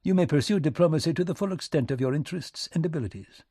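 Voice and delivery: high, quick diplomatic patter; British minister, condescending. Acted. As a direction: grant it briskly and formally.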